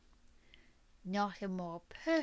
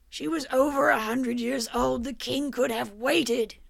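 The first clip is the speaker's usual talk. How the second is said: angry shout; 3 semitones higher, 9 dB louder than usual. A narrator's reading. Victor protests the old duchess's murder, shouting. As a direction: let the outrage burst out.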